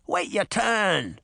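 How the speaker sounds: drawn-out croaky pirate; croaking. A narrator's reading. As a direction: croak it out, stretching the words. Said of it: "the man croaked".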